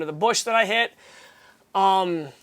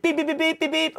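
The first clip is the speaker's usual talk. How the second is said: mock horn beeps; 7 semitones higher than usual. Imitating guy honking at him.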